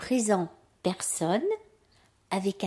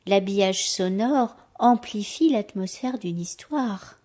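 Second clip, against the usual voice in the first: ordinary delivery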